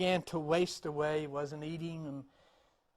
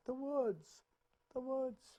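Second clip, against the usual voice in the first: soft, higher echo dying away; 6 semitones higher, 6 dB quieter than usual. Acted. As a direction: call back faintly from far off. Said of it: "the voice comes back".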